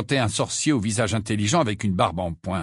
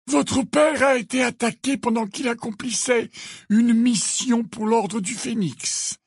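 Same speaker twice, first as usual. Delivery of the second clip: high, slow, deliberate voice; reply slowly in a raised pitch; measured, high-pitched rebuttal; Dumbledore, firm; 11 semitones higher than usual. A narrator's reading.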